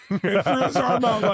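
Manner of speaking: laughing while retelling; giggling through the words. Retold or imitated. Retelling the awkward wave.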